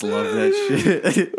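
laughing. Real, not acted. Laughing at the joke.

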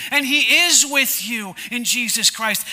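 ordinary talk

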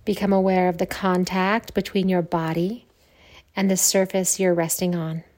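measured meditation voice with long silences; guided meditation. Acted. Yoga teacher opening a Yoga Nidra practice, guided meditation. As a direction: guide them calmly into stillness, letting phrases sink.

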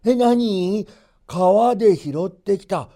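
put-on old man startled by the news; astonished. A narrator's reading. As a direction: blurt it out in disbelief. Grandfather can't believe it.

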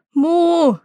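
high, arching imitated moo; loud cow moo. A narrator's reading. Bella the cow cries out to the farmer, mooing high. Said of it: "schrie Bella".

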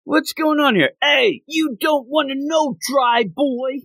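high, slow Brooklyn wiseguy voice; Brooklyn accent. Retold or imitated. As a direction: Brooklyn goldfish, Bugs Bunny style. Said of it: "like, a Brooklyn accent".

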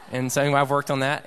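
normal talking voice

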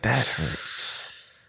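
soft whispered mock pain; whispered that hurts. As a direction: breathe it out like a hurt cartoon.

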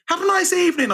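very high singsong farewell; put-on cheery goodbye. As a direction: chirp a mock-cheerful office goodbye, high-pitched. Imitating cheery goodbye when leaving office.

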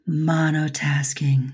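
slow breathy sexy whisper. Shown as a demonstration. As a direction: purr monotasking slowly, all breath. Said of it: "Say it in a sexy voice".

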